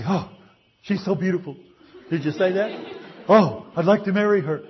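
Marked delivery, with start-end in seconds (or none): 0.1-1.6 lightly gushing lovestruck voice; a hint of a besotted admirer; mock smitten gushing. Mock infatuated churchgoer quote. 3.3-4.6 higher, louder, hammed-up besotted voice; sighing over a crush in mock delight; mock lovestruck gushing. Mock infatuated churchgoer quote.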